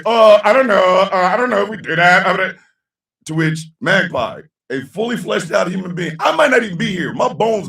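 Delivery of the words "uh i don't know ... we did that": flustered stammer. Retold or imitated. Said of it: "the person's gonna be like".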